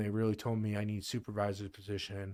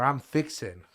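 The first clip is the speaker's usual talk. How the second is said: Texan phrase; 3 semitones higher, 7 dB louder than usual. Retold or imitated.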